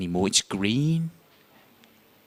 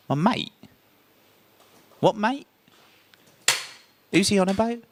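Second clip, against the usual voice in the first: baffled; 9 semitones higher than usual. Retold or imitated. Confused by brother's call.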